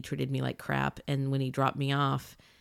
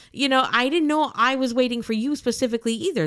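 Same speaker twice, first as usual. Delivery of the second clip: exasperated retort; 8 semitones higher, 6 dB louder than usual. Retold or imitated. Retorting to the late driver.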